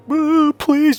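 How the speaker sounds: high, loud fake-crying wail; mock bawling. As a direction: imitate her begging through exaggerated tears. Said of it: "just bawling her eyes".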